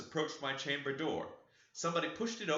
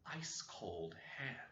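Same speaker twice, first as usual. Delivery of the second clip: whispered, slow and soft; hushed dread; 11 dB quieter than usual. A narrator's reading. Narrator touching the ghost's hand, whispered dread. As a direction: breathe it out in horror. Horror reveal in the dream.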